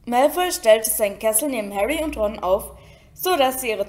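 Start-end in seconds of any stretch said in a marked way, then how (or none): none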